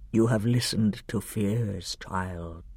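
deep, soft, grave lion; Aslan. A narrator's reading. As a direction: gently reprove in a deep voice. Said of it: "the deep voice said".

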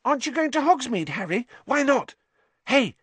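high, fast, eager chatter; eager boy. Acted. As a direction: pester him brightly like a starstruck kid. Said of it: "Colin looked eagerly around".

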